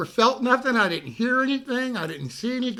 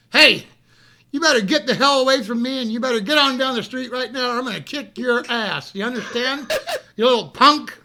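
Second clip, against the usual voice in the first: loud, higher threats, slowing on the last words; angry yell at a punk; 4 semitones higher, 6 dB louder than usual. Retold or imitated. Man yelling at a young mugger to get lost, loud and angry. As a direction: chase the kid off with a hammed-up yell. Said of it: "And I said".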